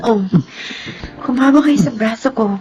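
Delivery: plain reading voice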